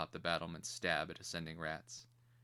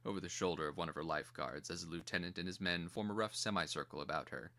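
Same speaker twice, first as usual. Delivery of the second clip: ordinary delivery, nothing marked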